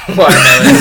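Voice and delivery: loud laughter breaking into the word; real laughing fit. Real, not acted. Reader cracks up at the prompt, laughing hard. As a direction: try to read through helpless laughter. Cracking up while reading aloud.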